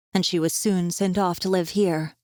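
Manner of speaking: ordinary delivery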